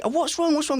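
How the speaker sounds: high, anxious "what's wrong"; worried parent. Retold or imitated. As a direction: ask a crying child, concerned. Parent asking crying daughter.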